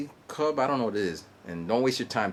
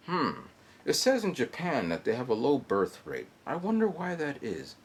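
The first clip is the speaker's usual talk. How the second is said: usual speaking voice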